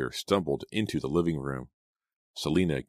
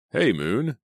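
ordinary delivery

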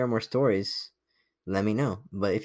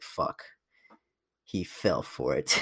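mock thought; 6 semitones lower, 6 dB quieter, slower than usual. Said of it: "deep down, she's like".

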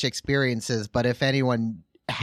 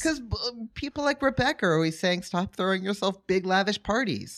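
high mock complaint; 7 semitones higher than usual. Gene high voice quoting critics.

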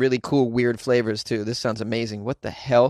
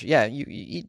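ordinary delivery

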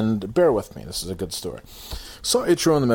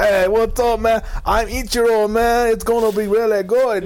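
Ichiro; 10 semitones higher, 5 dB louder than usual. Retold or imitated. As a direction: botch a Japanese accent into barrio Spanish. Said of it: "Did he just join the Latin Kings?".

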